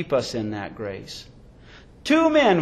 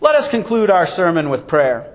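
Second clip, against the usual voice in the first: ordinary talk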